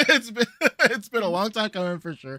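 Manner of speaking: real laughter breaking into the line; laughing. Real, not acted. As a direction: get it out while cracking up. Cracking up while repeating himself.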